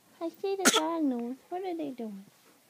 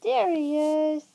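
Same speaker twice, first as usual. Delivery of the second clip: loud, slow peekaboo reveal; excited reveal; 8 dB louder, slower than usual. Real, not acted. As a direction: burst out with the reveal.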